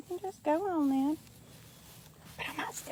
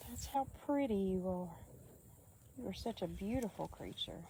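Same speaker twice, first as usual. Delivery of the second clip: soft cooing; 6 semitones lower, 19 dB quieter than usual. Real, not acted. Soothing animal.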